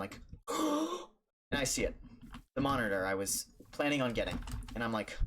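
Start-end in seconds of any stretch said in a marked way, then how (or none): 0.3-1.9 gasp, then slow and high with wonder; breathless discovery, drawn out slowly; gasping surprise. Retold or imitated. Spotting the monitor in the thrift store, gasping in awe.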